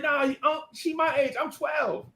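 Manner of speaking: put-on high kid's voice insisting; high boyish protest. Boy telling uncle the girl is his age, high. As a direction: squeak it out like a twelve-year-old. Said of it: "And I'm like".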